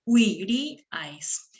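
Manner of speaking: slow, separated syllables; drawn-out teacher's syllabification. Shown as a demonstration. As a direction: sound out each syllable in turn. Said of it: "Vamos a silabear".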